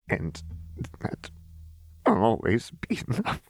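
halting, tearful, pitch climbing unevenly; voice breaking. A narrator's reading. Jinx repeats Lux's promise before breaking down, voice breaking. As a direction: struggle through it haltingly until the voice breaks high.

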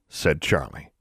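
ordinary delivery

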